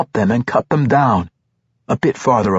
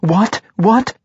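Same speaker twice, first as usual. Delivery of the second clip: higher, sharply rising, each one more insistent; startled double-take, pitched up and rising; startled questioning; 9 semitones higher than usual. A narrator's reading. A character reacting in alarm to news, startled questioning.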